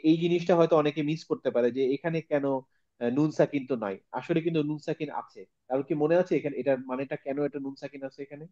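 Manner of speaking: ordinary talk, nothing marked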